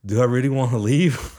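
young man tempted by mom's cooking, mock loud outburst; mock loud self-doubt. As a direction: blurt the doubt out loud as a joke. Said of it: "I was like".

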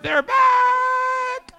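very high, loud, drawn-out comic scream; mock panicked scream. Restaurant staff at closing time, mock panicked scream. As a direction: scream it in hammed-up horror, stretching the last word.

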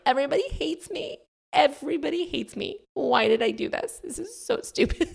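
high whiny imitation of panic; mock despair. Launching owner to herself, high mock wail. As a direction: whine it like a sulking teen.

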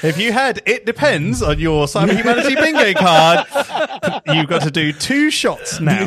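loud, high game-show callout; loud playful call. Acted. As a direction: announce it like a drinking-game rule. Bingo card game call.

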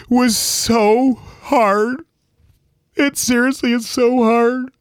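high, tearful voice, halting between phrases; choked up and drawn out; real grief. Real, not acted. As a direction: struggle to get it out through tears. Grieving a parent lost at fourteen.

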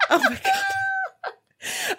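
high delighted laughter through the words; laughing. Real, not acted. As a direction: crack up at the nickname.

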